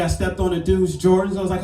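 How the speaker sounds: ordinary delivery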